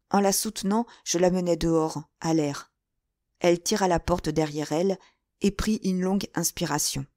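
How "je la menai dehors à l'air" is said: ordinary delivery, nothing marked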